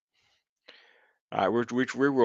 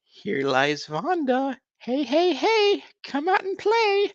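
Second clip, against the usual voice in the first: slow creepy graveside line, then a much higher hammed-up clown call; mock clown, rising to a far higher sing-song "hey, hey, hey"; Krusty the Clown; slower than usual. Streamer reading a gravestone to chat, in a hammed-up clown voice. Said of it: "my Krusty the Clown attempt at a voice".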